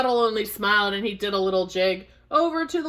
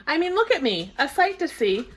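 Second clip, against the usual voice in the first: higher-voiced self-pitying puddle; grumbling puddle; 6 semitones higher than usual. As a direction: complain as a fed-up little puddle. Puddle speaks as story character.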